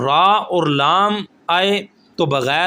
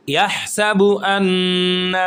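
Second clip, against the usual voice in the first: slow melodic chant of the verse; Quran recitation; much slower than usual. Shown as a demonstration. Teacher recites next verse for students, slow chant. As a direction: draw the words out in tajweed style. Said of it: "recites next verse".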